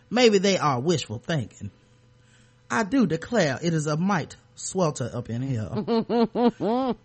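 slow, laughing Southern belle drawl; hammed-up Southern accent, laughing through it; mock Southern accent. Mock Southern accent for the councilman's quote. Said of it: "I wanna give him a Southern accent".

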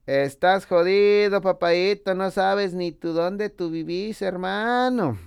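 put-on slow, high Guatemalan accent. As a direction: drawl it out like a Guatemalan mate. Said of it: "Así hablan allá en Guatemala". (acento guatemalteco, lento y agudo)

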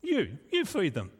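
incredulous echo, pitch swooping on "you"; mock-baffled reaction; disciples' disbelief. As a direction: repeat the order as if you can't believe it. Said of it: "understand the disciples' reaction".

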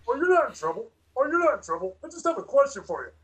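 high, friendly cop; officer voice. Acted. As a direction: reassure him like a pushy cop. Acted officer questioning.

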